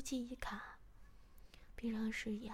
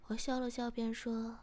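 ordinary delivery

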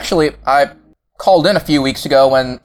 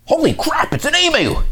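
shocked. Acted. Emu appears.

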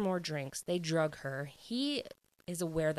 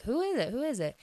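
high-pitched, louder curious questions; eager questioning; 7 semitones higher, 5 dB louder, faster than usual. Retold or imitated. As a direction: act out friends pressing eagerly for an answer. Retold friends eagerly questioning the bartender.

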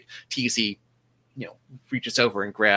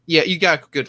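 ordinary talk, nothing marked

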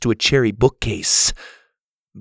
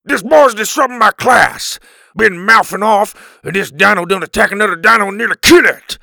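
high, loud, rough caveman rant; angry complaint; 9 semitones higher, 9 dB louder than usual. Acted. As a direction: rant at Pa in a rush.

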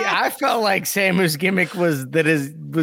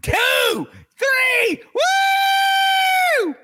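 high shouted ref count; slower than usual. Acted. Ref count, loud cheer.

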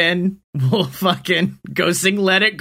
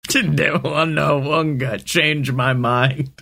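slow mock; 4 semitones lower, slower than usual. Mocking the general's word.

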